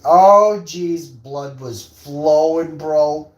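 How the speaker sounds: loud, high, drawn-out exclamation; overcome, stretching the words out; hammed-up arousal. Acting out excited reaction.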